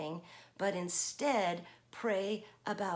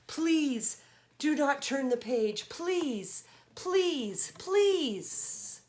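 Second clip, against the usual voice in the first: rising, louder begging please; pleading; 6 semitones higher, 6 dB louder, much slower than usual. Acted. Grover begging the children, pleading ever higher. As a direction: beg higher with each please.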